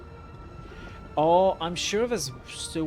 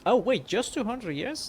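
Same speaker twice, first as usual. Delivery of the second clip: surprised; 8 semitones higher, 4 dB louder than usual. Real, not acted. Surprised at the small number.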